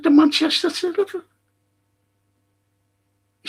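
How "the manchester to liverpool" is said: much higher, louder, fast mocking echo; furious retort. Mocking the fella's railway claim, furious shout. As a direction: throw his words back at him in a rage. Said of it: "I just went berserk".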